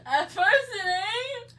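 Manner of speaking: high, slow, laughing words. Real, not acted. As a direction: drag it out through laughter. Laughing at the heat.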